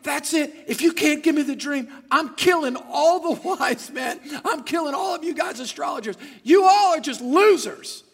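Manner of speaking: loud, high, hammed-up rage; mad king. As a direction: shout threats like a furious ruler. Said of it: "He's mad".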